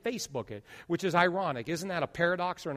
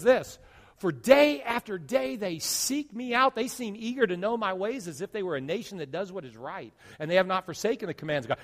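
impassioned scripture reading; 3 semitones higher, 3 dB louder than usual. Acted.

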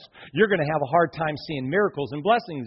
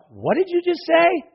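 high-pitched incredulous question; acted disbelief; 11 semitones higher than usual. Preacher recalls hearing the pastor's prayer, incredulous. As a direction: replay your astonishment, pitch jumping way up. Acts out shocked reaction to prayer.

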